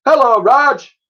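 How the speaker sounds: high loud hello across the market; calling out. Acted. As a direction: call over the noise. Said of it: "She called out to him".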